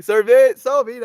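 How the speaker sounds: yelped apology; loud sorry. Real, not acted. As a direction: blurt out sorry, sorry loudly and high.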